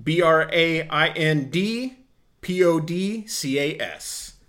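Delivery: letters spelled out slowly one by one. Shown as a demonstration. Host spelling the podcast handle slowly for listeners. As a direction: spell each letter out deliberately. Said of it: "So it's P E A".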